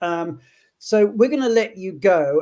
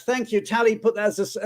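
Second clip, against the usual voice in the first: ordinary talk, nothing marked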